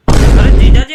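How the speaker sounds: loud shout across the room; calling out. Acted. Uncle calling out loudly to his brother-in-law. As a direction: bellow "अरे जीजा जी".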